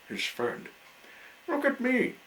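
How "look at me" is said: high, loud, far-carrying clown call. A narrator's reading. Clown showing off to his friend, calling out high and loud. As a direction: call out to be seen. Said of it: "he called".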